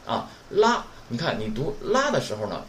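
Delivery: ordinary talk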